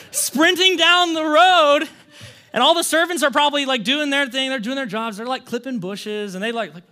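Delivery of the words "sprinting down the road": laughing. Real, not acted. Father sprinting, laughing telling.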